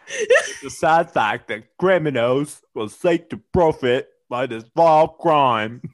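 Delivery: badly faked British newsreader accent; mock British accent with laughter bubbling under. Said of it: "you sounded like one of the Beatles".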